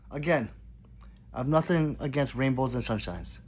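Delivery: ordinary delivery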